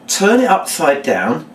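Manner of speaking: deliberate first run-through; reading the words. Shown as a demonstration. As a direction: announce the step, stretching it out. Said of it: "just reading the words".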